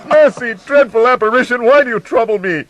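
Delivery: high, loud terrified pleading; horrified pleading. Acted. Scrooge begs the dreadful apparition, loud panicked pleading. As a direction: beg the phantom in panic.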